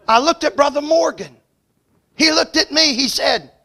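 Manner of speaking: loud, high preacher's delivery; preaching at full force. Acted. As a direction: drive the story home from the pulpit.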